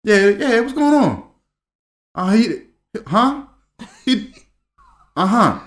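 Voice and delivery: halting one-sided phone call, acted out; dad on phone. Dad hearing the news by phone, taken aback.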